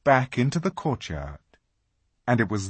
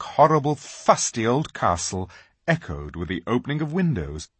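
ordinary delivery, nothing marked

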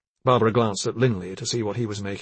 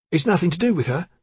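heated, louder, higher denial; hotly; 7 semitones higher, 4 dB louder than usual. Acted. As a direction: snap back defensively. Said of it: "he said hotly".

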